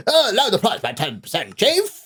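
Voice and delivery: high mock American accent, rising hard on Chief; a high-pitched brash American voice; AI-filtered American accent. Shown as a demonstration. The seller hears the caller in a high mock American accent. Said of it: "use the A I to filter, to do an accent for you".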